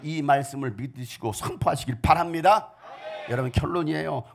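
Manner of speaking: usual speaking voice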